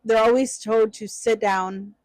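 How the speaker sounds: normal talking voice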